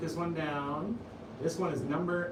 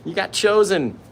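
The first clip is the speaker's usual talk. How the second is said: mock cheer; 6 semitones higher, 12 dB louder, much slower than usual. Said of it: "Ken's like".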